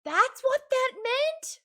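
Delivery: high, stunned disbelief; shocked. Real, not acted. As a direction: gasp out the question in shock. Shocked realization about Syl.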